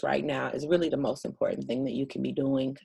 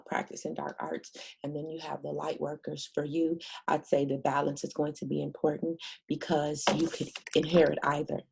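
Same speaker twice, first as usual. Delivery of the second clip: ordinary talk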